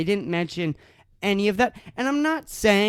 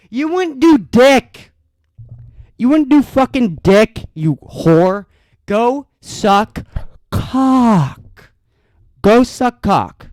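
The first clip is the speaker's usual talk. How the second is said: loud, slow, furious yelling; furious shout; 3 semitones higher, 8 dB louder, slower than usual. Real, not acted. Ranting vlogger yells at hashtag posters, furious shout. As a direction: spit each word out in open rage.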